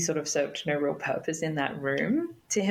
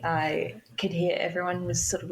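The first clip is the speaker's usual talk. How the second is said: normal talking voice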